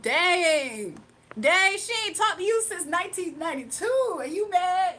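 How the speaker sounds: loud, high mocking jab; loudly roasting a bitter commenter. Real, not acted.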